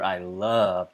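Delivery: "love" stretched very slowly; stress on love. Shown as a demonstration. Teacher repeating an example for emphasis, long slow "love". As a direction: hold "love" long to make it stand out. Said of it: "you wanna emphasize that love".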